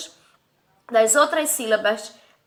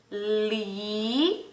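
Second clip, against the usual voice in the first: "Lixo" split in two, each syllable held, a long gap between; 4 semitones lower, 6 dB quieter than usual. Shown as a demonstration. Teacher showing pupils the two syllables, syllable by syllable. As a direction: stretch "Li", stop, then say "xo". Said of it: "abri a minha boquinha pra pronunciar".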